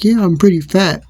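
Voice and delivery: high, loud retold retort; re-enacted high-pitched quip; cheeky comeback. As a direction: toss back an acted wisecrack. Joking comeback in quarrel.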